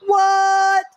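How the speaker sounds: high, loud burst of disbelief; excited shout. Real, not acted. Watching a fight with friends, excited shout. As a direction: shout it in amazement at the finish. Reacting live to a fight finish.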